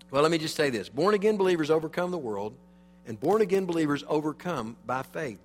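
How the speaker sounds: ordinary delivery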